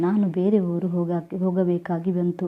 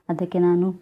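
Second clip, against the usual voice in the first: ordinary delivery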